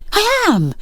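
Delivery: high, emphatic protest; insisting. Acted. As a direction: insist a bit too hard. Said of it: "he insisted".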